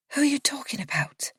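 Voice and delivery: high, breathless whisper; frightened whisper. A narrator's reading. Narrator asks Miriana, a frightened whisper. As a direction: ask it in a scared hush. Frightened question in dark room.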